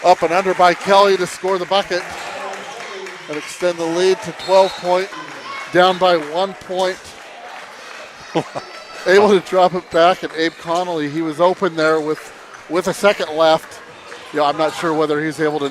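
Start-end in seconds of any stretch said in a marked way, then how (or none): 0.0-7.0 loud, high excited call. Real, not acted. Big basket called out. 8.3-13.8 fast, rising sports call; play-by-play. Acted. Live basketball play-by-play.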